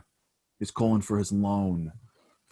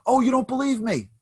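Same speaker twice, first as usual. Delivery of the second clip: much higher and louder, daring; playful challenge; 7 dB louder than usual. Retold or imitated. Warren Buffett daring the doubtful CEO, high and challenging. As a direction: call his bluff.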